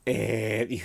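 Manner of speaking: slow, drawn-out horror host voice; spooky host intro. Acted. Host greets listeners in a slow spooky voice. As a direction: lay on the creepy showman.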